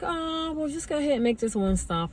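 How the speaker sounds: high, quick God voice. Retold or imitated. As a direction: voice God as light and breezy. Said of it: "And God was like".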